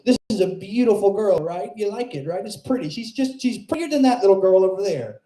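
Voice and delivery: put-on high, twangy old barfly voice; aunt needling the comic, high and mocking. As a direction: lean in like a leering old aunt. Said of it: "and says".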